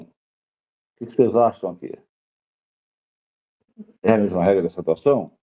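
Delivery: usual speaking voice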